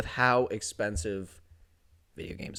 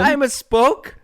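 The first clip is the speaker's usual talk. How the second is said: laughing; 12 semitones higher, 6 dB louder, slower than usual. Real, not acted. Laughing at own slip.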